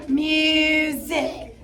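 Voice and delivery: "Music" said slowly, each syllable set apart as the hand drops under the chin; syllables dropped. Shown as a demonstration. Teacher to class, showing how to drop the word Music, syllables set apart. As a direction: stretch "Music" out, one drop per syllable. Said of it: "We're gonna drop it".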